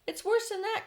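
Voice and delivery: high, loud dread; frightened cry. A narrator's reading. Police chief crying out in horror at Miss Spider. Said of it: "cried the chief of the police".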